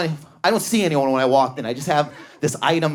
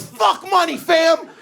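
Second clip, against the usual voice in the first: high, shouted dismissal; hammed-up, punchy high shout; mock salesman yell; 11 semitones higher, slower than usual. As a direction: imitate a salesman hollering down an objection. Salesman yells down objection.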